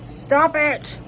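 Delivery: tease-scold the cat; playful scold. Real, not acted. Scolding her cat while showing the haul, loud and playful.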